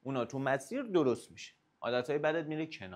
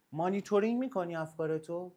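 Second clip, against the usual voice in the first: ordinary talk, nothing marked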